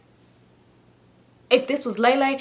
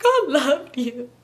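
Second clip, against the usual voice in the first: slow, drawn-out mock sobbing; crying voice; 2 semitones higher, much slower than usual. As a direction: bawl each word out, hammed up. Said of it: "it's still me crying voice".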